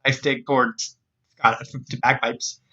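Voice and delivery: ordinary delivery, nothing marked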